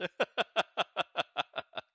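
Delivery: high, loud run of laughter; laughing fit. Real, not acted. Streamer still amused by the accent attempt, loud high laughter. Cracks up again.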